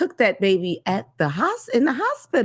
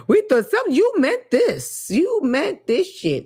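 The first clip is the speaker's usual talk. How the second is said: mocking retort; 7 semitones higher than usual. Mocking what he really meant.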